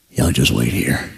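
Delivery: very low mock-heroic voice; grave hunter. Hunter telling police to wait, deep and grave. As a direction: give a deep, understated order. Henderson addressing police before the hunt.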